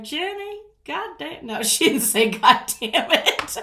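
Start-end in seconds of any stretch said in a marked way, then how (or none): 0.0-1.5 high-pitched imitation of a Virginia accent; thick Virginia accent. Daughter imitating her mom calling her, thick Virginia accent. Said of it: "she's got this very deep, thick Virginia accent". 2.3-3.6 loud, slow Virginia drawl breaking into laughter; thick Virginia accent. Retold or imitated. Daughter laughing as she mimics mom's cussing, thick Virginia accent. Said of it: "thick Virginia accent".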